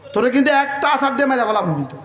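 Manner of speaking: fast, hurried mock threat; rushed threat. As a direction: rattle off the threat quickly. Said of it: "আপনাদের ভাষায় কি বলে". (দ্রুত হুমকির সুরে)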